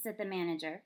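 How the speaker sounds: ordinary delivery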